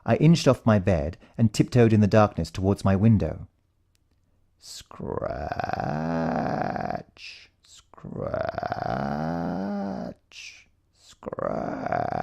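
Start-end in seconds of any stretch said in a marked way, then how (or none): 4.6-12.2 hushed, drawn-out creepy sound words; a spooky whispered scratch, scratch; eerie soft scratching. A narrator's reading. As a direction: breathe them out like something in the dark. Eerie sound in dark room.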